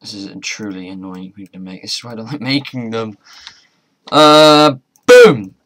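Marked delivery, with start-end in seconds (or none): 4.1-5.5 sudden loud triumphant boom; excited boom. Real, not acted. Gamer shouting boom as the craft finally works.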